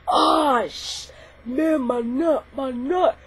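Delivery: lightly voiced pained groaning boy; mock groan of pain. Kicked boy clutches himself, mock pained groan.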